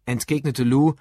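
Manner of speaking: ordinary delivery, nothing marked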